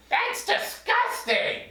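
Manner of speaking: very high, slow, disgusted squeak; grossed-out puppet. Acted. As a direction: pull a face and squeal it. Puppet reacts to saliva fact.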